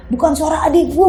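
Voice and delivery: usual speaking voice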